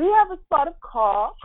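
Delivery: high, loud hammed-up New England accent; mock New England accent. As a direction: put on a playful New England voice. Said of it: "as if I'm from New England".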